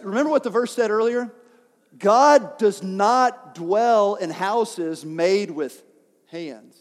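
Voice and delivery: ordinary talk, nothing marked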